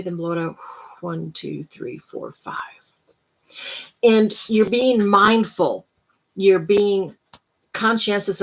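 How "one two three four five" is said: soft counting, fading to a whisper; quiet counting. Shown as a demonstration. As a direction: count gently, letting it fade. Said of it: "Hold it for about two or three seconds".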